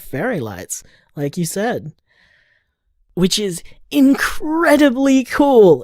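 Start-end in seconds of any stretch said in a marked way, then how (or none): 3.1-5.8 high-pitched enthusiasm; impressed. Acted. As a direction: gush with delight, pitch lifted. Gushing over Helen's fairy lights.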